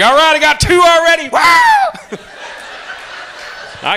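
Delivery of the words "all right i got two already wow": high, loud excited shout; whooping with joy at getting the finger; gleeful traffic glee. Pretend. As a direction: cheer like you just won a prize. Excited driver counting middle fingers.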